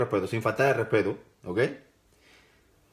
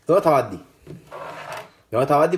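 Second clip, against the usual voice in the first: loud demanding call; 4 dB louder than usual. Real, not acted. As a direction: shout out for Bardi. Calling out for Bardi.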